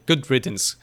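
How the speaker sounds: ordinary delivery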